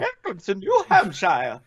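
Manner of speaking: slow, high mock New Hampshire accent. Friend jokes in a New Hampshire accent, hammed-up and slow. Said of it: "It's good with a New Hampshire accent".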